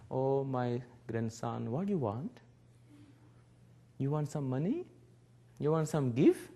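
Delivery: lightly voiced grandpa spoiling him; tender grandfather. Grandfather offering his grandson money, soft and doting.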